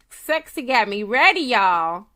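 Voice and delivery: hyped. Real, not acted. Hyped about her scent.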